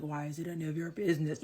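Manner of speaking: brother's snippy retort. Retold or imitated. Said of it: "He's like".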